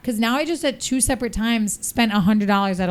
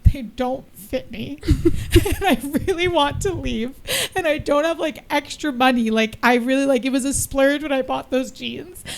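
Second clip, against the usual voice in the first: high, wavering mock-tearful voice; retold tearful pleading; 4 semitones higher than usual. Customer tearfully explaining the jeans to cashiers, retold tearful pleading. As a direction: plead as if tearing up, pitched up. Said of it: "now I'm starting to tear up".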